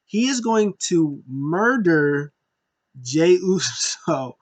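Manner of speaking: "he is going to murder Jey Uso" spelled out word by word, slow; slow emphatic stress. Shown as a demonstration. Fan insisting on his prediction, slow and stressed. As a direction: lean on every word. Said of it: "I said he was gonna mur".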